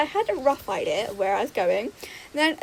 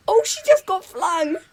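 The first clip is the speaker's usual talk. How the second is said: gasp, then high, excited outcry; startled exclamation; 7 semitones higher, 5 dB louder than usual. Real, not acted. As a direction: blurt it out high in real surprise. Live reaction to a game mishap.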